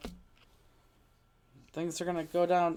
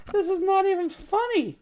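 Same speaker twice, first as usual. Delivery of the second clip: high, loud disbelief; loud, high-pitched exasperation, easing slightly; exasperated outburst; 9 semitones higher, 7 dB louder than usual. Real, not acted. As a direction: burst out in frustration at your own bad luck. Exasperated over the missing piece.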